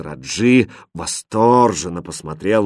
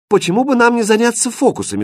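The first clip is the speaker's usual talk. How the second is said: very high, lively question; eager king; 13 semitones higher, 4 dB louder, faster than usual. A narrator's reading. King ignoring queen and jester, high and eager. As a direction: propose it in a high, keen voice. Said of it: "спросил король, не обратив никакого внимания".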